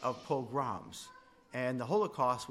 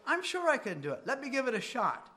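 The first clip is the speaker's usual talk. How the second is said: higher, louder, bright can-do voice; eager confidence; 10 semitones higher, 5 dB louder than usual. Retold or imitated. Telling a friend you can manage it, eager and sure. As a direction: sound sure of yourself out loud. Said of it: "Or if I say to you".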